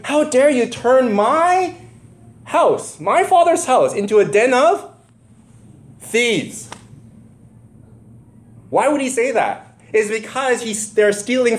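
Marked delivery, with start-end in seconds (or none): 0.0-6.7 high, slow, anguished cry of a hurt man. Retold or imitated. Jesus confronting temple thieves, crying and outraged. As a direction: wail it as a wounded accusation. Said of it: "because he was crying, going".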